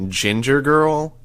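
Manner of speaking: slow, arch questioning of the nickname. A narrator's reading. Sierra repeats the nickname the medic gave her, archly. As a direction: drawl it back, amused and a little offended.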